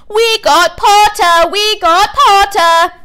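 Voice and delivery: loud, slow chanted cheer; gleeful twins' chant; twins yelling. A narrator's reading. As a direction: crow it in triumph. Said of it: "the Weasley twins yelled".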